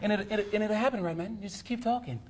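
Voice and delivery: put-on high, light joke British accent; mock British accent. Comedian coaches the host on accents, high mock British. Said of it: "How do you get a British accent?".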